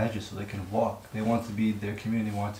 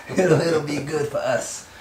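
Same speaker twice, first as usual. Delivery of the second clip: laughing; 5 semitones higher, 6 dB louder, slower than usual. Real, not acted. Laughing through mock fundraising pitch.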